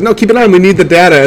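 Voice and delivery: high-pitched, lightly acted urgency; mock urgent insistence. Scientist refusing to stop the test, mock urgent. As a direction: imitate someone urgently overruling a panic. Acting Bruce urging her during test.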